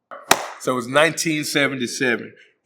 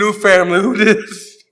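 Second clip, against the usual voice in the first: high joking exclaim; 7 semitones higher, 7 dB louder than usual. Real, not acted.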